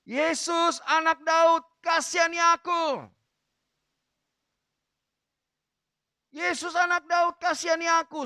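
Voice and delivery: full acted shout, high and loud. Beggar refusing to be silenced, shouting to Jesus at high pitch. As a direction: shout the plea high, stretching the first call. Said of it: "dia makin keras, dia berseru".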